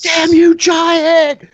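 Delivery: high, loud, strained yell; angry foe's shout. Acted. Club-swinging foe curses the giant, high strained shout. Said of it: "He's like".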